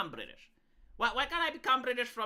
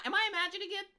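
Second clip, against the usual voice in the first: normal talking voice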